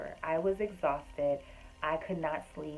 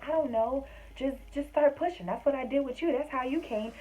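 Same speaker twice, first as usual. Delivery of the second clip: higher, louder mock-matter-of-fact mom; insistent mom; 9 semitones higher, 4 dB louder than usual. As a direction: play the know-it-all mother.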